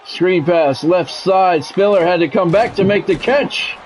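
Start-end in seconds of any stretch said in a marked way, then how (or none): none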